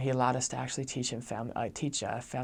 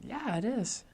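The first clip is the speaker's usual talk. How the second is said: surprised yeah; 10 semitones higher, slower than usual. Retold or imitated. Missionary surprised when asked about his birthday, high surprised yes. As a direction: pitch jumps high, caught off guard.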